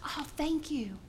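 soft, breathy whisper of thanks. Acted. Mother whispering thanks to her neighbor. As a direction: whisper it, relieved.

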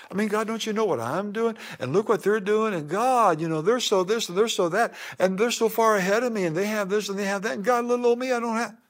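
high, rushing-on mock whine to God; envious complaining. Envious believer grumbling to God about others being ahead, mock complaining. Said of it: "And you wonder".

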